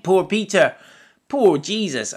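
emphatic pity. Real, not acted. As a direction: lift it up, full of feeling. Pastor pities Peter, repeats it.

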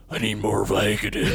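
rough mock American character voice; House. Imitating Hugh Laurie's American role, grumbled doctor voice. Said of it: "And then he's house".

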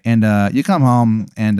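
usual speaking voice

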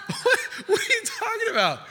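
high, laughing, baffled what are you talking about; baffled disciple. Retold or imitated. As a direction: squeak it out in mock disbelief.

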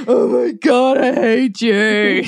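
slow, dragged-out groan; groaning annoyance.

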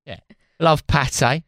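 lightly voiced Cockney-style Frenchman; a hint of a London geezer playing French. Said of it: "why is this a French geezer".

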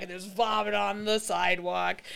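ordinary talk, nothing marked

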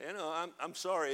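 ordinary talk, nothing marked

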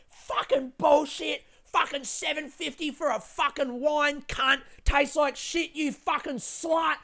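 high, loud impression of a screaming woman; imitated screaming. Drunk woman on a train ranting about her wine, imitated screaming. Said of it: "she's screaming, going, like".

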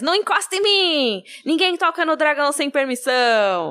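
indignant, high-pitched outburst; Viserys. Acted. Viserys warning Jorah off, haughty indignation. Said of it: "aí o Viserys".

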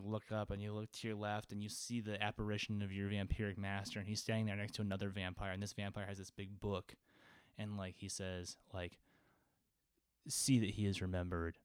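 low, quiet, solemn scene narration; hushed narration. Acted. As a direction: draw them into the grim vampire scene.

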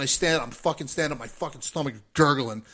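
ordinary delivery

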